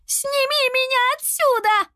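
high-pitched desperate scream. A narrator's reading. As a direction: shriek to be rescued. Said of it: "закричала Лиана". (отчаянно кричит)

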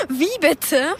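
bursts out laughing in disbelief. Acted. Girl laughing at a boy's love confession. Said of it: "Entschuldige, dass ich gelacht habe".

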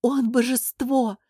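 slow, high adoring exclamation; rapturous. Acted. Court lady exalts the absent prince, rapturous. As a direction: proclaim it slowly in rapture.

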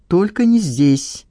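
mildly flustered, drawn-out objection; a touch of sudden alarm. A narrator's reading. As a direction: stretch it out, slightly panicky. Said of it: "всполошилась Надежда".

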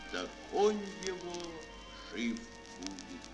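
normal talking voice

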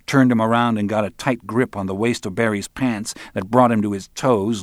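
plain reading voice

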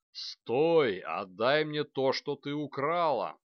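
loud, booming demand; roaring dragon. A narrator's reading. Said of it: "взревел дракон".

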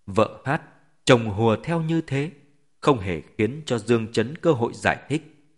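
ordinary delivery, nothing marked

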